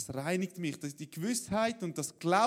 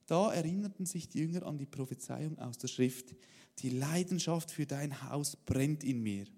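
louder, steady reading of the Bible story; scripture reading; 3 semitones lower, 4 dB louder than usual. Acted. Pastor reading the temple story to the church, measured reading. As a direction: read the passage out to the congregation. Reads the Bible passage aloud.

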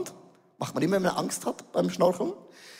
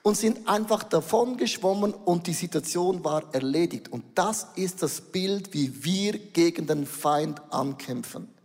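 ordinary talk

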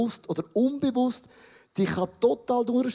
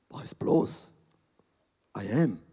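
whispered mock indignation; 10 semitones lower, 3 dB quieter, slower than usual. Mock offended reaction to insult.

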